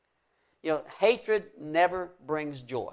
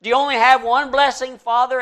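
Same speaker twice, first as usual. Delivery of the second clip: high, louder pleading cry of a son; crying plea; 8 semitones higher, 7 dB louder than usual. Retold or imitated. Son begging his father, raised pleading mock cry. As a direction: beg the father through mock tears. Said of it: "he cried".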